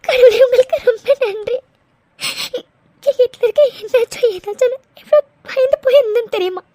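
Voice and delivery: tearful thanks to God; sobbing with relief; crying. Acted. Girl thanking God after hearing her fiancé is alive, crying. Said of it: "please டி, அழாதடி".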